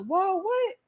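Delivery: mock shock, voice jumping up; startled whoa. Retelling her startled reaction to the dream lady's words. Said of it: "And I was like".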